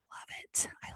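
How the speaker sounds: breathy excited whisper. Real, not acted. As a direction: whisper it like a secret thrill. Gushing about puzzling.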